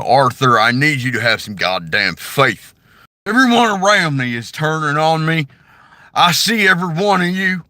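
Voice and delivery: rally the gang, accusing; Dutch impression. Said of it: "that, that's, you know, Dutch".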